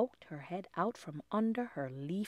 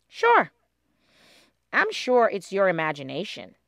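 reassuring; 12 dB louder than usual. A narrator's reading. Molly reassuring.

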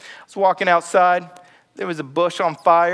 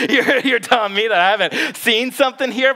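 ordinary delivery

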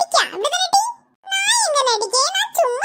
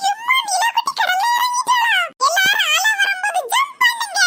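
put-on excited child shout; 6 semitones higher than usual. Child calling to mum as the baby enters the sea, thrilled and shouting. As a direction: shriek it out with pure glee.

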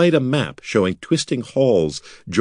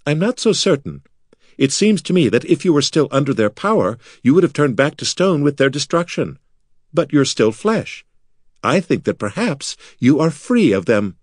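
higher-pitched, reflective wizard voice; thoughtful wizard; 6 semitones higher than usual. Acted. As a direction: reason it out gently in a higher voice. Voices thoughtful Bekla in the story.

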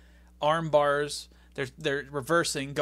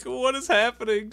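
higher, louder, baffled cry; crowd's disbelief; 8 semitones higher, 5 dB louder than usual. Retold or imitated. Retelling the crowd's baffled reaction, higher and louder. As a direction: voice the stunned crowd. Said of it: "It was like".

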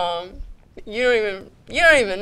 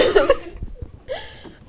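teary high-pitched giggle; high helpless laugh. Real, not acted. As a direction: laugh it off mid-farewell. Laughing at own tearful apologies.

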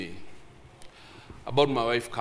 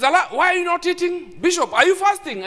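high, loud calling question; 14 semitones higher, 6 dB louder than usual. Retold or imitated. Guy at breakfast questioning the bishop, loud and high. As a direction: ask it loud across the table. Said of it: "he says it loud".

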